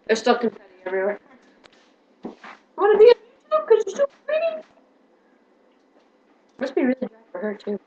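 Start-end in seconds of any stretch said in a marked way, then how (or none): 2.8-4.6 high, sing-song pet voice. Real, not acted. Cooing at the dog.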